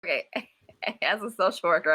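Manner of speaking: ordinary talk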